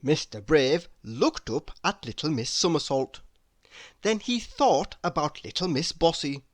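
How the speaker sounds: ordinary delivery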